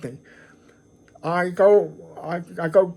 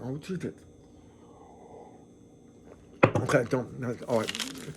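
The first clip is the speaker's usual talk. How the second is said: quiet, choked-up voice, holding back tears; 5 semitones lower, 11 dB quieter than usual. Real, not acted. Vlogger to viewers, suddenly overcome, quiet and choked up. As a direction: swallow the emotion and try to steady yourself. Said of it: "I'm getting a little emotional again".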